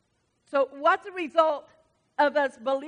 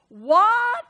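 much higher, louder rising what; surprised what; 9 semitones higher, 10 dB louder than usual. Real, not acted. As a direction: jump on it in disbelief. Surprised exclamation.

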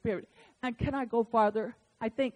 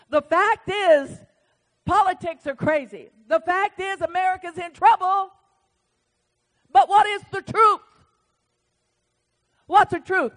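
louder, higher preaching build; full-force preaching; 6 semitones higher, 8 dB louder than usual. Acted. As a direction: drive it home like a revival preacher.

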